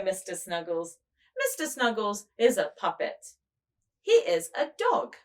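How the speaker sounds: ordinary delivery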